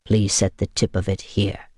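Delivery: steady and courteous, low; calm vampire. A narrator's reading. Vampire asks her to cut him quickly, calmly. Constantine's voice.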